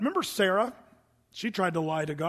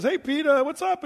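high, breezy greeting; a bright, high, falsely casual hello; casual Sapphira; 7 semitones higher, 5 dB louder than usual. Retold or imitated. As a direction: act all innocent and chummy.

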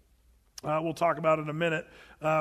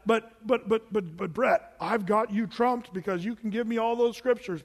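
ordinary talk, nothing marked

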